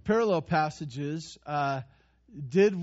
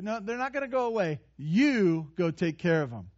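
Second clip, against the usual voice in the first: ordinary talk, nothing marked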